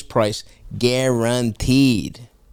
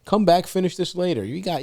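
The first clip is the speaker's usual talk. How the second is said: ordinary talk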